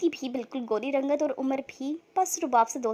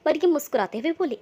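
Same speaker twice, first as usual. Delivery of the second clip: ordinary delivery, nothing marked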